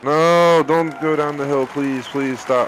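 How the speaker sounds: loud high no fading to slow begging; panicked pleading. Real, not acted. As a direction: beg the ball to stop, panicking. Ball rolling toward the slope.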